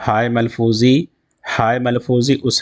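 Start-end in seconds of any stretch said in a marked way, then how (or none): none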